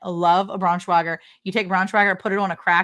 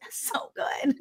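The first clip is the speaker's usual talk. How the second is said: breathy whispered relish; whispering; 7 semitones higher, 9 dB quieter, slower than usual. Real, not acted. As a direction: whisper it like a guilty pleasure. Whispered relish over food.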